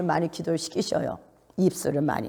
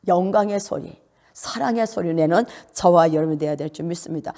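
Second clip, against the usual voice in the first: normal talking voice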